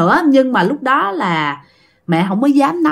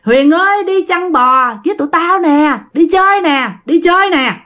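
calling out; 7 semitones higher than usual. Acted. Three friends beckoning Huyền to herd cattle, calling out. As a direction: coax a friend to come out, calling high. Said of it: "cái tiếng của ba đứa kia nó kêu".

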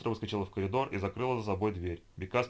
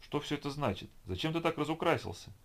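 shocked; 7 semitones higher than usual. A narrator's reading. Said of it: "шокированно спросила Лидия".

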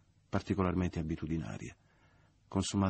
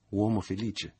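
higher, louder ironic cry; ironic exclamation; 4 semitones higher, 6 dB louder, slower than usual. A narrator's reading. Michele mocking Leo's happiness, ironic. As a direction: hail him with open irony. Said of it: "esclamò Michele ironico".

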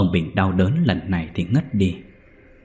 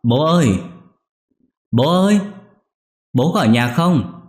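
high, loud call to father; calling loudly; 7 semitones higher, 4 dB louder than usual. Acted. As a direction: call out across the gate. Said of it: "Anh Nhất gọi lớn".

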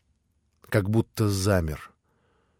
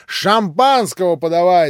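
loud, higher, rough demand for champagne; shouting; 8 semitones higher, 7 dB louder than usual. A narrator's reading. Rakitin shouts for champagne, loud and high. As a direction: yell it across the room. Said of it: "закричал Ракитин".

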